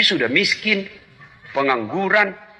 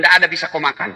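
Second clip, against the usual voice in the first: normal talking voice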